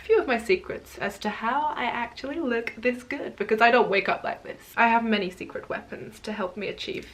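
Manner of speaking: hammed-up American accent as Wonder Woman; cheesy fake American accent, playing Wonder Woman; terrible American accent. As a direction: brag in a mock American superhero accent. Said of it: "Sorry about that terrible accent".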